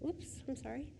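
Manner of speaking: normal talking voice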